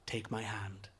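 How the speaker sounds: low, very soft invitation; quiet, calming offer; reassuring. A narrator's reading. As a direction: coax a frightened partner gently. Girl reassures the panicking boy.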